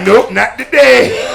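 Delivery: very slow, loud deadpan nope; drawn-out smug denial; mock refusal.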